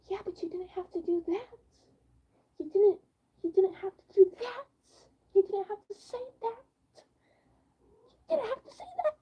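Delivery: rising into a high, teary whine; hammed-up sob of protest; mock crying plea. Pleading with him over the kitty remark, mock crying. Retold pleading, cry heard.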